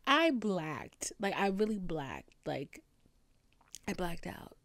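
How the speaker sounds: ordinary talk, nothing marked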